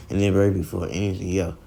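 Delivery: plain reading voice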